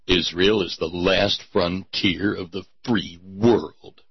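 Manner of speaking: low solemn pronouncement; grave slogan read. Acted. First solemn reading of the slogan. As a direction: intone it like a trailer narrator.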